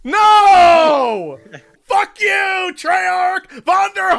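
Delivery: long, drawn-out howls of protest; raging yell. Real, not acted. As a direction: bellow it at the game's makers. Raging at an error and the developers.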